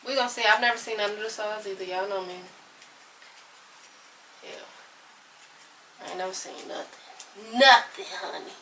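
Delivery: ordinary talk, nothing marked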